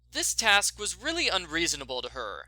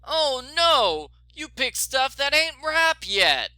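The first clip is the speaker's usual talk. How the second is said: louder, higher, slow dismay; Oh no, pitched up and pushed out in disapproval; disapproving; 9 semitones higher, 6 dB louder, slower than usual. Acted. Rancher scolding the girl detective over unripe vegetables, loud dismayed disapproval. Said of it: "his face was disapproving".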